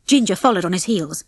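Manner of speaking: usual speaking voice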